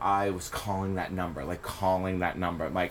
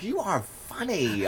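mock awed fan; 10 semitones higher, slower than usual. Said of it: "I'd be like".

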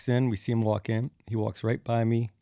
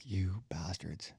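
slow, unvoiced aside; whispered curse; 2 semitones lower, 13 dB quieter, much slower than usual. Bassist left alone with Gene, slow whispered curse. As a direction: breathe the curse under your breath. Muttering as band abandons him.

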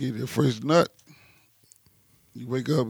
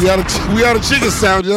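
put-on pitched up, louder, bored counter girl; mock rude cashier; 6 semitones higher, 6 dB louder than usual. Retelling the cashier who ran out of chicken, mock bored cashier voice. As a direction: toss it off like a fed-up cashier. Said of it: "Bitch said".

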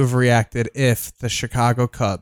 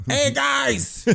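much higher, hammed-up Chicago greeting; loud Chicagoan; 11 semitones higher, 5 dB quieter than usual. Imitating the apartment handyman arriving, high Chicago accent. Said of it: "this loud fucking Chicagoan".

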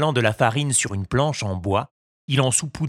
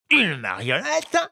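puzzled fisherman; 6 semitones higher than usual. A narrator's reading. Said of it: "répondit le pêcheur".

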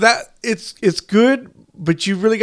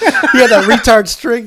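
louder, higher words breaking into laughter; laughing joke; 4 semitones higher, 7 dB louder than usual. Real, not acted. Joking with a friend about the film, laughing. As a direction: blurt it out while cracking up.

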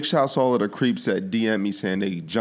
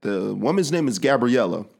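normal talking voice

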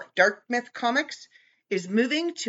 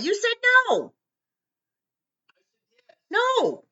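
much higher, hammed-up accusing voice; mock accusation of a co-host; 3 dB louder than usual. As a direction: throw it back at him. Retelling a quarrel with co-host.

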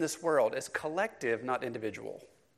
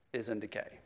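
ordinary talk

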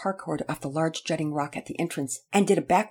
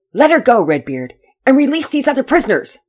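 loud, higher firm command; stern order; 8 semitones higher, 12 dB louder than usual. Acted. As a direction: order him with iron authority. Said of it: "Pearson sternly ordered".

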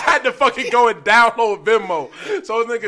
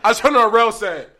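ordinary talk

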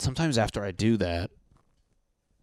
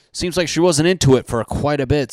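mock indignation; 6 semitones higher, 8 dB louder than usual. Mocking the orgasm question.

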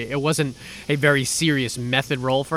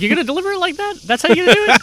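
high, fast mock disbelief; incredulous; 5 dB louder, faster than usual. Real, not acted. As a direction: tease him for that delivery. Teasing partner's line reading.

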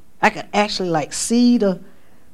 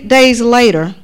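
normal talking voice

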